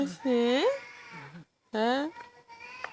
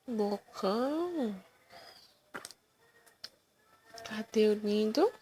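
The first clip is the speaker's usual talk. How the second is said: soft cooing; 9 dB quieter than usual. Real, not acted.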